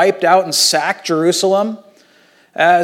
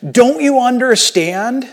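higher and louder, pleading; Jesus imagined calling to the crowd, raised pleading voice; imagined shout; 7 semitones higher, 4 dB louder than usual. Retold or imitated. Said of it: "start shouting at people".

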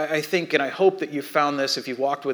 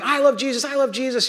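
high-pitched mock chant of children; imitated kids' shouting; 9 semitones higher than usual. Other kids joining in from across the room, mock shouting. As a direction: chant it like kids, pitched up without real volume. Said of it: "all the other kids start shouting".

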